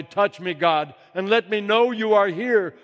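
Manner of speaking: high, loud cry of joy; joyful cry. Acted. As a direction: call out to God overjoyed. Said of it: "I cried out in joy".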